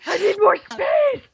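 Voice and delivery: high, slow, hammed-up wail of despair; mock panic. Teasing the cohost about her envelopes, a mock wail. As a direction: overplay the panic for laughs.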